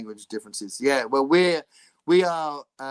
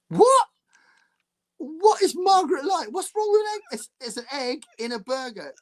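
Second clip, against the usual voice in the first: high, loud indignant shout; mock outrage; 13 semitones higher, 6 dB louder than usual. Real, not acted. Host defending the egg burger, loud mock outrage.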